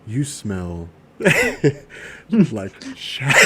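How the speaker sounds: very slow, drawn-out delivery. Acted. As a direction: drag each word out slowly. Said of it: "imagine a voice comes on".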